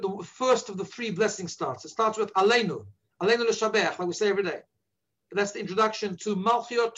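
normal talking voice

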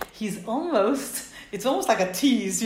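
ordinary talk, nothing marked

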